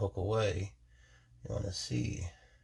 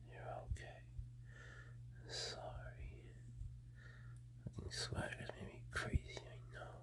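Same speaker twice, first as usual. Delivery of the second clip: soothing whisper; 9 semitones lower, 12 dB quieter than usual. Real, not acted. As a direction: hush her down like a skittish horse. Soothing a nervous animal.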